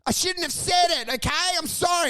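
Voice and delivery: high, whining apology from the ground; hammed-up pitiful excuses; acted pleading. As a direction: beg off an attacker. Beaten man begs on the ground.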